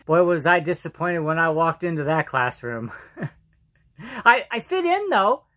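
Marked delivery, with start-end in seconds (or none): none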